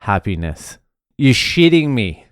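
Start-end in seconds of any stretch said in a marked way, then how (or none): 1.2-2.3 higher and louder, delighted; thrilled fake outrage; gleeful disbelief. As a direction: let the joy show openly. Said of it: "Pure, unadulterated happiness".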